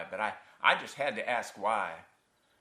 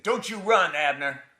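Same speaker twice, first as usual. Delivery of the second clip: very high, very loud shout; father's full-force yell; 10 semitones higher, 11 dB louder than usual. Acted. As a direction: scream the order and demand an answer. Father grabs fleeing son, yells.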